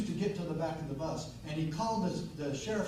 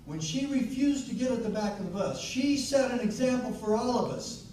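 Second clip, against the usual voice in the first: loud, high, forceful speech to a crowd; impassioned rally oratory; 8 semitones higher, 12 dB louder than usual. Acted. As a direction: drive it home like a fiery stump speaker. Rallies audience at full force.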